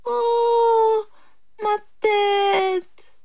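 high, drawn-out moan; lamenting jackal. A narrator's reading. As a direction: wail about it in a high put-on voice. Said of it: "se lamenta le chacal". (lamentation aiguë de chacal)

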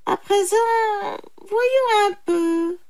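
high, slow, drawn-out musing; jackal pondering. A narrator's reading. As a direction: stretch it out high, as if puzzling. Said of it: "dit le chacal". (chacal songeur, aigu et traînant)

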